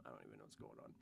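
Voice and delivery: breathy whispered aside; whispering. Real, not acted. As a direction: whisper it to yourself. Confused aside after reading chat.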